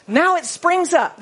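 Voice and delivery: grand, loud proclamation of "Now it springs up"; booming scripture line; big grand reading. Shown as a demonstration. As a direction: make it sound huge. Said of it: "That sounds big".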